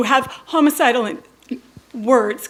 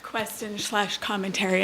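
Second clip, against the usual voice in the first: usual speaking voice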